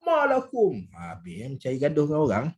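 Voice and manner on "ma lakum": high, raised "Ma lakum"; mock confrontational shout, pitched way up; demonstrated yell. Shown as a demonstration. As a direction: yell it as if starting a fight. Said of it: "Kita pun jerit".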